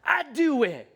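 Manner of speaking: put-on high, insistent three-year-old voice; imitating a toddler. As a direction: play the stubborn little kid saying it over and over. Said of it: "he'd be like".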